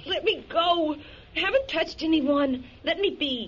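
high, desperate begging; frightened pleading. Acted. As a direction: plead to be let go. Captive woman begs to be freed.

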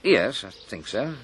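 slow, hesitant agreement; half-hearted, drawn-out assent; unsure. Acted. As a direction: agree slowly, as if not quite convinced. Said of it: "You don't sound so sure".

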